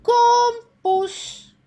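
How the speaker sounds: loud high call to the cat; high, loud "Kom, poes" to a cat. A narrator's reading. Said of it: "Kim roept".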